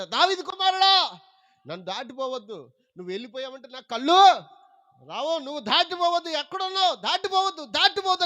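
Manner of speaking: high, loud, begging cry that builds; pleading shout. Retold or imitated. Blind man begging Jesus not to pass, rising pleading shout. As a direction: plead at the top of the voice not to be passed. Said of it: "గట్టిగా అరుస్తున్నాడంట".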